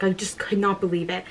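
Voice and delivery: ordinary talk, nothing marked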